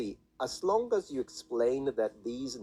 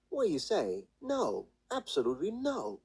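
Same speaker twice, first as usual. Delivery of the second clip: higher, emphatic falling tones; mock angry protest; 8 semitones higher than usual. Shown as a demonstration. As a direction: act out indignant refusal to show the fourth tone. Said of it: "So kinda imagine it as".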